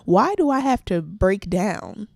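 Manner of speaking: ordinary talk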